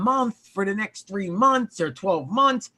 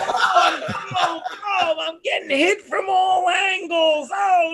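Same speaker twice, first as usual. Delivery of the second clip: high-pitched protesting wail; exasperated wail; 7 semitones higher, slower than usual. Real, not acted. Host ganged up on by co-hosts, exasperated wail. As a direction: wail as if besieged.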